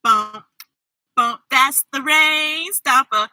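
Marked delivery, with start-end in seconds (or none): none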